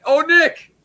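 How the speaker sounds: teasing himself in his wife's mocking voice; mock taunt. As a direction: ham up the jab at him. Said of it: "and I say, fucking, like".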